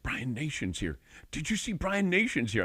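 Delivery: hushed-then-high excited fan voice; imitating excited Dublin locals. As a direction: mimic starstruck locals pointing him out. Fans excitedly spotting the distiller.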